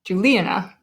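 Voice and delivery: "Juliana" as ju-LI-a-na; stress on second syllable. Shown as a demonstration. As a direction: lean on the LI in "Juliana". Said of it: "Do we say".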